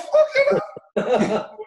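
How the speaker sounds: very high, loud fucking hell; startled cry as Tyson steps into the lift; shocked exclamation. Retold or imitated. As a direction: yelp it out in disbelief. Startled thought, Tyson gets in.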